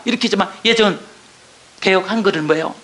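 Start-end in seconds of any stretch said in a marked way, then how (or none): none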